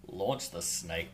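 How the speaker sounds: "Launch" drawn out slowly with weight; slow stress on launch. Shown as a demonstration. Joking about flinging the snake, slow stressed launch. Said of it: "I'm not gonna say launch".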